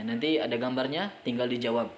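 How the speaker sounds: usual speaking voice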